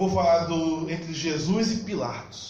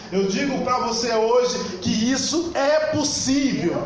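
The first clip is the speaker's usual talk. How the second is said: raised, measured proclamation; emphatic preaching; 4 semitones higher than usual. Real, not acted. Preacher promising the congregation a word from God, emphatic preaching. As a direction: declare it firmly, climbing in pitch.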